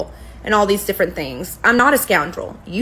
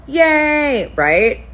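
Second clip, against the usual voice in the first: mock cheer; 6 semitones higher, 3 dB louder than usual. Real, not acted. Sarcastic celebration of dismissed case.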